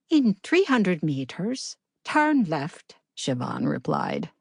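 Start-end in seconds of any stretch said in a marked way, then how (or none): 0.1-3.0 higher, gentle Irish navigation voice; a calm Irish sat-nav, pitched up; soothing Irish accent. Acted. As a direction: GPS voice answering the driver, higher soothing Irish lilt. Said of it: "soothing Irish accent".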